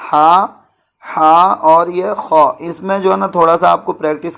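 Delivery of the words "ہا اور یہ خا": ہا and خا slowly breathed from the throat; throat sounds. Shown as a demonstration. Teacher showing students the throaty ہا and خا. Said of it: "حلق سے نکلتے ہیں دونوں لفظ".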